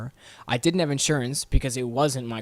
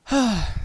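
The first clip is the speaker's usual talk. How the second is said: high, audible exhale; exasperated sigh; 8 semitones higher than usual. Real, not acted. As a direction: let out a sigh after the rant. Sighs after the rant.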